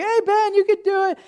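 high, singsong encouragement; imitating playful high-pitched cheering. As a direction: call up playfully to cheer on a kid. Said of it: "at first it's kind of playful".

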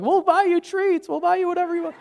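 high, singsong mock pleading; high-pitched wheedling mom impression; coaxing mom. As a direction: imitate a mother bribing her child. Mom voiced, bribing scared son.

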